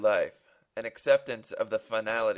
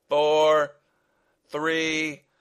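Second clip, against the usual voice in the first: loud, raised, relentless count; countdown; 6 semitones higher, 8 dB louder than usual. Acted. Gunman continuing the deadly countdown, loud and insistent. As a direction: call out each number. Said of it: "continued the voice behind the mask".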